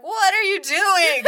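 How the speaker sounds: high, slow, loud laughing yell; angry drivers. Acted. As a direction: yell at the reckless cyclist. Drivers yelling at cyclist.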